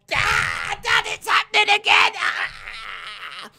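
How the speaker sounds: high, wailing cry for help; hammed-up terrified son screaming; panicked shriek. Dominic attacked in the car, hammed-up wailing shriek.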